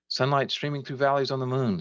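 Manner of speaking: high, projected call; shouted over noise. A narrator's reading. Sam answering over his camera's shutter, shouted. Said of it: "Sam shouted over his camera's".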